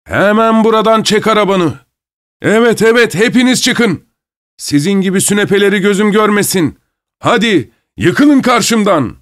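high-pitched, indignant tirade; masked man's high, blustering outburst; outraged bully. A narrator's reading. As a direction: throw them all out in a fury. Masked man explodes at the insult.

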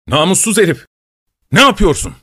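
high, indignant outburst; outraged scolding. A narrator's reading. Boss catching his employee robbing, outraged. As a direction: demand an explanation, pitched high.

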